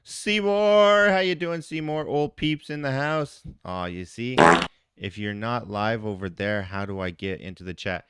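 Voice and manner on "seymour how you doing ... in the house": high, loud, happy hello called out; calling a viewer's name with loud joy; excited greeting. Real, not acted.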